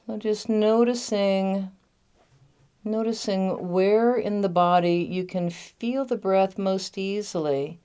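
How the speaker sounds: measured meditation guide with long pauses; unhurried guiding voice, lingering on the key words; guided meditation. Real, not acted. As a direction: lead them gently inward, phrase by phrase. Leads a recorded guided meditation.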